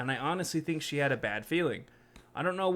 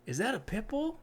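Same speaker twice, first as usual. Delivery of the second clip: stranger's question; 7 semitones higher, 4 dB quieter than usual. Retold or imitated. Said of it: "He asked me".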